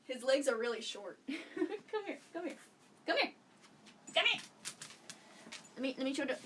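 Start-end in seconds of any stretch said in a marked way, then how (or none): 1.3-4.4 high-pitched dog call; real coaxing call to a dog. Real, not acted. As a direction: coax a pet over in short high calls. Calling the dog over.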